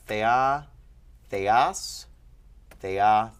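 thea and theas each stressed on the last syllable; final-syllable stress. Shown as a demonstration. Teacher reciting a declension, end-stressed thea forms. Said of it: "where the accent wants to persist".

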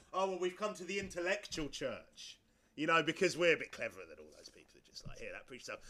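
low, hushed, self-satisfied superiority; mock intellectual snob. Preacher mocking those who feel cleverer, smug and low.

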